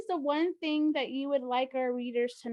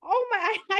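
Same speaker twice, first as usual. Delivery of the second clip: surprised laugh; 8 semitones higher, 9 dB louder, faster than usual. Real, not acted. Hears shared hidden talent.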